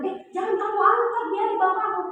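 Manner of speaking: a mother warning her daughter not to lift the heavy water jug; alarmed scolding. Retold or imitated. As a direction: stop her before she lifts it.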